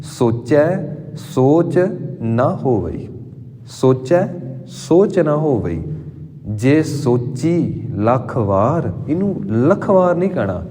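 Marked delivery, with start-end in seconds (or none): 0.0-3.1 ਸੋਚ given weight, drawn out slowly; teacher weights the word ਸੋਚ in recitation; stress on ਸੋਚ. Shown as a demonstration. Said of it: "ਸੋਚ ਨੂੰ ਬੋਲਣ ਲੱਗਿਆਂ ਵੀ ਸਟ੍ਰੈਸ ਪਾਉਣਾ ਪਏਗਾ". 3.7-8.9 slow recitation with ਸੋਚ stressed; every letter clear, unhurried; teacher recites the line clearly for students; clear, weighted recitation. Shown as a demonstration. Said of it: "ਫਿਰ ਇਹ ਅੱਖਰ ਕਲੀਅਰ ਹੋਣਗੇ".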